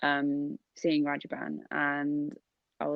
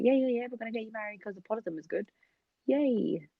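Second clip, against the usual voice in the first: higher-pitched, hurried mock enthusiasm; a touch of eager parent, pitched up; lightly voiced parents; 6 semitones higher than usual. Parents pushing a match on their child, lightly mocked eagerness. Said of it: "your mum and dad are like".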